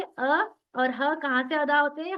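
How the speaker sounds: normal talking voice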